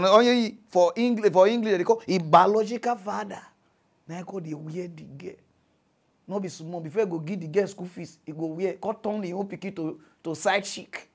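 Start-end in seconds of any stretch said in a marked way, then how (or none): none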